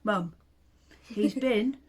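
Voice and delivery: loud, urgent calling to mum; acted excited announcement, loud and eager; excited phone call, acted. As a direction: call out the big news down the phone. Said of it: "and he'd say".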